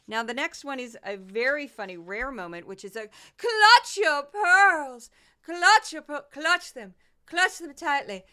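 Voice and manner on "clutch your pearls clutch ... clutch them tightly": hammed-up Southern drawl, much higher, louder and slow; mock Southern belle clutching her pearls in horror. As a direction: gasp it out like a scandalized Southern lady. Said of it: "today she's Southern".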